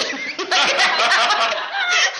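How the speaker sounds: high, loud laugh of joy; a joyful laugh at her own excitement; delighted laugh. Real, not acted. Overjoyed at the dog's look.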